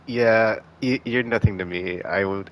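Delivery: normal talking voice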